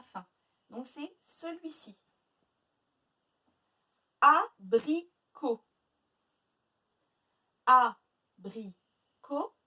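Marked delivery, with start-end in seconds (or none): 4.2-5.6 ABRICOT as loud a, bri, co with pauses; split into syllables. Shown as a demonstration. Teacher giving pupils a new word, syllable by syllable. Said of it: "Trois syllabes". 7.6-9.5 ABRICOT very slow, loud A, then bri, co; three beats of Abricot with long gaps; split into syllables. Shown as a demonstration. Teacher counting three syllables, syllable by syllable. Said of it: "Trois syllabes".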